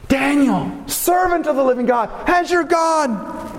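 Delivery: pleading call. Acted. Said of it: "He cries out".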